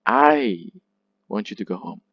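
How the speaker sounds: emphatic I, pause, weaker remainder; stress on I. Shown as a demonstration. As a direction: lean hard on I, let the rest drop away. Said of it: "the I is pronounced loud and clear".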